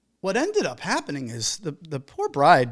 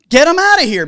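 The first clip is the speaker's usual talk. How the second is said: loud and higher, forceful; loud firm command to drop them; loud emphatic push; 8 semitones higher, 12 dB louder than usual. Real, not acted. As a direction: shove the point out loud. Urging vendors to drop couples.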